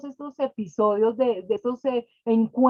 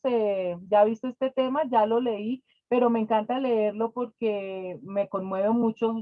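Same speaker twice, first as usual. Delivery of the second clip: ordinary talk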